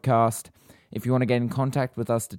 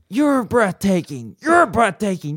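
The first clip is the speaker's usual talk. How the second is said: Keanu impression; 11 semitones higher, 6 dB louder than usual. Co-host asked for Winston, gives Keanu voice. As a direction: gush like Keanu on stage. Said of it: "I want you to do Winston".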